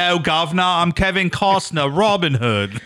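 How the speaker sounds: a cheesy fake English accent; mock English accent. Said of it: "Guy did a great English accent".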